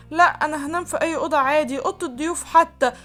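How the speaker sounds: high, alarmed protest; shocked refusal. A narrator's reading. Bride to husband ordering her to his room, shocked refusal. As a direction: plead her way out, flustered. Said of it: "فتتصدم ناني وتقول".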